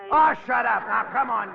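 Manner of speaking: loud annoyed snap; exasperated yell. Acted. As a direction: shout it in exasperation. Exasperated yelling at partner.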